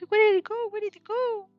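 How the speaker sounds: normal talking voice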